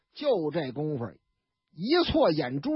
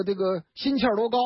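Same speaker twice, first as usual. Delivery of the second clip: usual speaking voice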